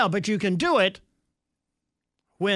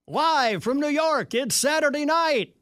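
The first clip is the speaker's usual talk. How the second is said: mock announcer; 7 semitones higher than usual. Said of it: "I thought she was gonna end up by saying".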